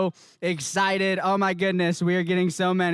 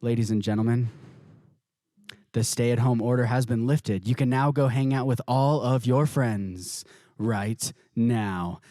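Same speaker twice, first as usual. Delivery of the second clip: news announcer; 9 semitones lower than usual. Retold or imitated. Reporter tells viewers the stay-at-home order is lifted, solemn and low. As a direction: deliver it like an official bulletin. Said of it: "they go".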